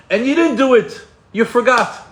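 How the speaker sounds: high, loud burst of mock dismay; dramatic outcry. Teacher dramatising someone forgetting a planned mitzvah, loud outcry. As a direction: call out the lapse at full voice. Dramatises a forgotten good deed.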